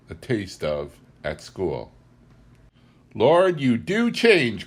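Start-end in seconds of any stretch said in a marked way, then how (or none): 3.1-4.7 slow, higher, louder exclamation; the housekeeper crying out in dismay; cried out. A narrator's reading. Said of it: "cried my friend".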